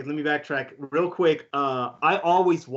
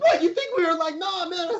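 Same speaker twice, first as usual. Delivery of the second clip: mock panic. Retold panic, stage manager's dialogue.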